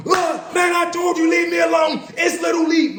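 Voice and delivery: high shouting back, loud; the referee shouting at the head coach after the punch, high and loud; going off. Retold or imitated. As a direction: yell like the punch was deserved. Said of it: "Now he going off".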